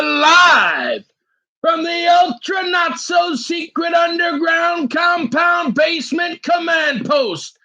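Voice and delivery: high, drawn-out announcer call; hammed-up live show intro. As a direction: stretch out a big mock broadcast introduction.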